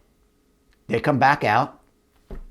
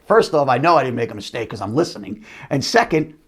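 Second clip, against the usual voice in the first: normal talking voice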